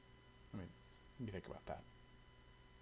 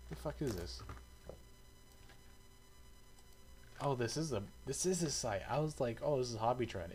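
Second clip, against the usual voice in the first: surprised; 7 semitones higher than usual. Real, not acted. Surprised at the listing he sees.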